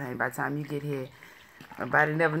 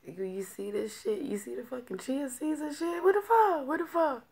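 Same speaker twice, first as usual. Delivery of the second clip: rising disbelief, much higher; can't believe what's floating in it; shocked; 9 semitones higher than usual. Real, not acted. Shocked at seeds in drink.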